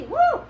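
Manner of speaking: high loud whoop; excited woo. Real, not acted. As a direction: cheer out loud. Celebrating blistered tomatoes.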